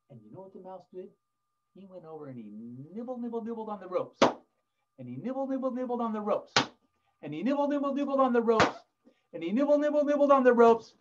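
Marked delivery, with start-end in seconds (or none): 2.9-10.9 high, quick patter, building in volume; nibbling refrain. A narrator's reading. Storyteller shows mouse gnawing ropes, high building patter. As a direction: nibble through the words, rising each round.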